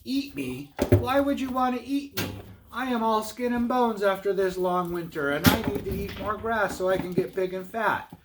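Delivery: high-pitched goat voice, reasoning; high storybook persuasion; calm middle goat. Acted. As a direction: argue your way out as the middle goat. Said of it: "Middle Billy Goat Gruff stayed calm".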